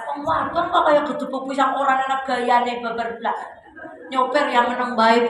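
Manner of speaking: normal talking voice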